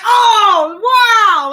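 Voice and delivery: loud, high "oh wow"; surprised shout. Retold or imitated. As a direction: shout it out in shock. Guys stunned by the answer.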